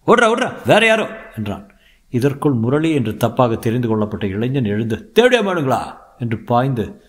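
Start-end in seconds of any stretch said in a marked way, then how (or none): 0.1-1.1 high, loud cry to run; acted panicky yell, pitched way up; urgent warning shout. A narrator's reading. Warning friend to run, mistaken identity. 5.1-6.0 high, loud furious challenge; acted enraged cry; angry outburst. A narrator's reading. Attacked youth leaps up at Dilip.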